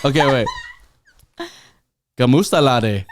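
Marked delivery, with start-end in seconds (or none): 2.2-3.0 put-on retried Irish accent. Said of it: "it was more Filipino".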